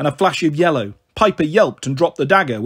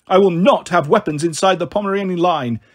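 ordinary delivery, nothing marked

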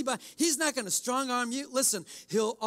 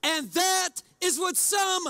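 mock self-righteous shout; 8 semitones higher, 5 dB louder, much slower than usual. Shown as a demonstration. Hammed-up self-righteous finale.

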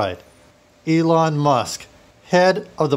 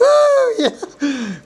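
loud falsetto whoop dropping off; giddy glee; 5 dB louder than usual. Acted. Faux tycoon delighted by his tea, giddy glee. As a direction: squeal it high with laughter. Gleeful villain over stolen tea.

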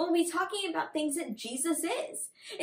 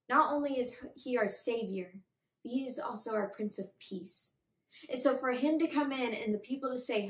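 usual speaking voice

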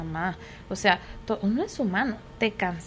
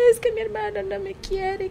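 put-on higher child's complaint; whining child; 9 semitones higher, 4 dB quieter than usual. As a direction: whine like a kid. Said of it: "niño se queja". (queja de niño, más aguda)

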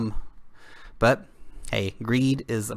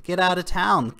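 "Get out of town" repeated at normal loudness, falling on "out"; 6 semitones higher than usual. Real, not acted. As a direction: let it drop, done with him. Repeats the scoff.

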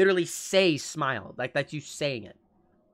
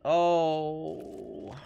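ordinary talk, nothing marked

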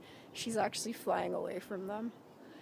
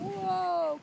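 surprised whoa; 9 semitones higher, 7 dB louder than usual. Real, not acted. Ducks crash landing.

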